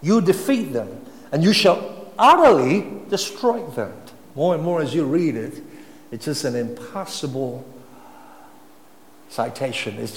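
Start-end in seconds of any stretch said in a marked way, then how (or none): 0.0-3.9 loud proclamation. Acted. Preacher voicing God's command.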